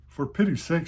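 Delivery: high, loud shocked gasp of words. A narrator's reading. Kitten shocked by the hymn-book story, shocked cry. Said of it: "said Gabriel in a shocked voice".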